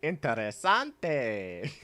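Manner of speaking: slow, drawn-out Spanish "Interesante"; mock Spanish accent. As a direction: savor "Interesante" like a phony Spanish speaker. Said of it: "in Spanish or in some phony accent".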